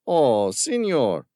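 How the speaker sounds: put-on slow, patronizing Spanish accent on senor; affected Spanish accent. A narrator's reading. Villain patronizing Tom with affected Spanish accent. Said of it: "the accent affected, patronizing".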